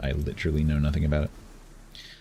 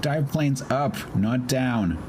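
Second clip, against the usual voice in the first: high frantic yelping; 9 semitones higher, much slower than usual. Real, not acted. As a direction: yelp it in a frantic rush. Frantic about dive planes.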